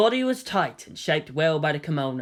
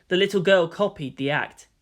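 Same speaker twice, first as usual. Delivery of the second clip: ordinary delivery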